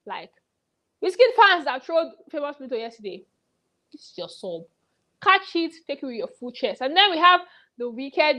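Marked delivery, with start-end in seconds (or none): 3.9-4.6 dropped, soft jab; low aside. Real, not acted. Vlogger taunting the trolling fans softly. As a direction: say it low and pointed.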